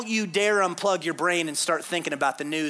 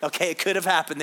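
ordinary talk, nothing marked

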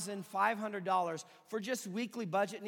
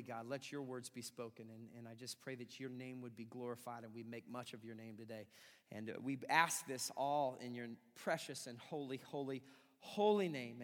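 soft, low, earnest prayer voice; 7 semitones lower, 7 dB quieter than usual. Acted. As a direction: pray quietly and sincerely. Leads a prayer.

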